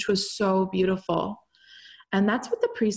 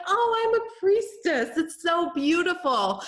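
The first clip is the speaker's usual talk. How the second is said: much higher, mock-dreamy gushing; mocking dreamy priestess; 11 semitones higher than usual. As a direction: parody someone swooning over being a priestess. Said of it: "some people are like".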